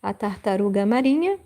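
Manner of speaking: A tartaruga marinha rattled off fast; quick repeat. Shown as a demonstration. Teacher repeating the Portuguese phrase briskly. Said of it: "I say".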